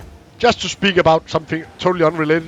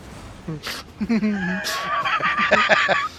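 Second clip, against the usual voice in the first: shrieking laugh; 4 dB quieter than usual. Real, not acted. Laughing at pirate joke.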